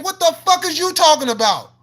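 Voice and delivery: ordinary talk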